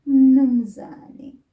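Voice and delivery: "Mnumzane" said loudly with no "u", falling; direct address, loud. Shown as a demonstration. Teacher shows the direct form loudly. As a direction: call out "Mnumzane" firmly. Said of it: "we say".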